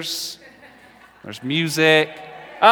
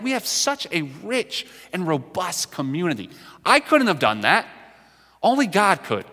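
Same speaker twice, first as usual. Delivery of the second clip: fervent preaching; 6 semitones higher than usual. Acted. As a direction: preach it with growing joy. Pastor rejoicing over his church.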